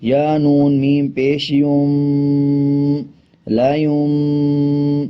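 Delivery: میم and یوم stretched with nasal hum; slow nasal recitation; ghunna on یوم. Shown as a demonstration. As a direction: draw out یا میم پیش یوم slowly through the nose. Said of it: "آپ اس کو یوں بھی پڑھ سکتے ہیں".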